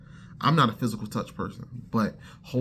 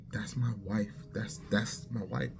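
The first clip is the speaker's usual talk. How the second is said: hushed, breathless whisper, softer; awed whisper; 8 dB quieter than usual. Retold or imitated. Seeing his future wife in a dream, whispered in awe. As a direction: whisper it in quiet wonder.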